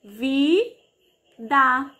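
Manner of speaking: "Vida" split into a long vi, pause, da; syllable by syllable. Shown as a demonstration. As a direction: stretch both syllables of "Vida" apart. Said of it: "Vamos contar vida".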